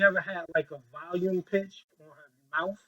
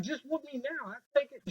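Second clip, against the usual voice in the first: higher-pitched, acted begging; mock child's plea; 6 semitones higher, 7 dB quieter than usual. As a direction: plead like a kid asking to get it over with. Acting child begging after mother's whisper.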